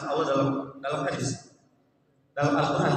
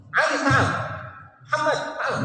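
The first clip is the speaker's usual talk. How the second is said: snapped summons; 12 semitones higher than usual. Retold or imitated.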